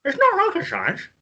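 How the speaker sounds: talking around a mouthguard; words mushed by a mouthguard; wearing a mouthguard. Real, not acted. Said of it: "whoever's reading their saying needs to put the mouth guard in".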